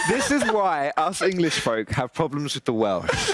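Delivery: slow, laughing through the words. Real, not acted. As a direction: joke while laughing hard. Laughing while joking.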